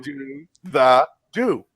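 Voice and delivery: slow "Do the do", every word separate; overly slow enunciation. Shown as a demonstration. Mocking friends' correction, slow and overdone. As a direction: drag out "Do the do" word by word. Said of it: "Why are you enunciating?".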